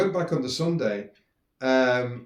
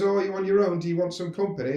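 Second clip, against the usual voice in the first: mock call-out; 6 semitones higher, 3 dB louder than usual. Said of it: "he shouts over to the girl".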